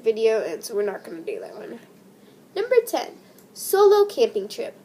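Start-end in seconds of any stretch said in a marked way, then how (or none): 2.5-4.7 chirpy announcement. Real, not acted. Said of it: "announcing next entry".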